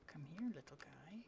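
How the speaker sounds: soft unvoiced whisper, rising at the end; coaxing whisper. Real, not acted. Calling the cat over, coaxing whisper. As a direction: coax him over gently.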